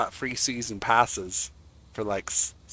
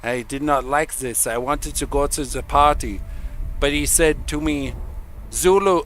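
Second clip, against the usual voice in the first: bad Swedish accent; 2 semitones higher than usual. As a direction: imitate a Swedish comic with a clumsy accent. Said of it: "I can't do the Swedish accent".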